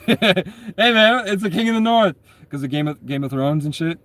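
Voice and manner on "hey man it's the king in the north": high, loud hype shout of the nickname; hyped-up crowd call. Retold or imitated. People on the street hailing him, excited call. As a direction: call it out like fans greeting a hero. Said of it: "they're like".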